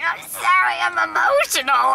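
mock-weepy, slightly high apology; imitating being overcome with emotion; retold tearful apology. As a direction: act out blubbering at the booth. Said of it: "crying at her booth".

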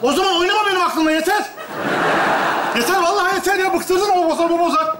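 high-pitched, fed-up scolding; exasperated outburst. Acted. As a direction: snap at the old man, patience gone. Fed-up father snaps at grandfather.

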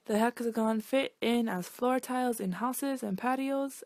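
usual speaking voice

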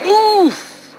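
very high, loud winded grunt; knocked breathless by the impact; winded oof. A narrator's reading. Bulstrode hit by falling trucks, winded oof. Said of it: "he exclaimed".

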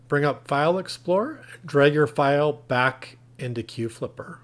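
ordinary talk, nothing marked

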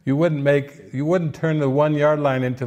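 ordinary talk

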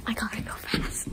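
whispering while hiding. Real, not acted. Rushing to hide.

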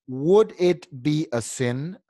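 slow, word-by-word teacher's reading; measured demonstration, each word set apart; model reading. Shown as a demonstration. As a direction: read it out slowly for a learner to repeat. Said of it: "Yo lo voy a leer primero".

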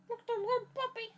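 high, quick cooing puppy talk. Real, not acted. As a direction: gush at a puppy. Talking to toy puppy.

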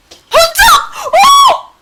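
high screaming cheer; ecstatic shout. Real, not acted. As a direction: scream with sheer delight. (흥분해서 지르는 고함)

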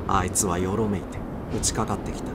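ordinary delivery, nothing marked

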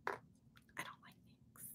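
barely audible whisper; whispered aside. Pretend. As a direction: whisper it like a secret confession. Repeats the remark as an aside.

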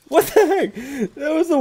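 high-pitched, incredulous outburst; exasperated disbelief. Real, not acted. Reacting to a terrible grenade throw.

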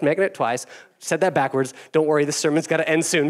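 usual speaking voice

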